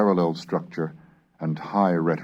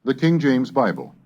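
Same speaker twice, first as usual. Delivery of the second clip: title read; 8 semitones higher, 5 dB louder than usual. Acted. Announcing the topic.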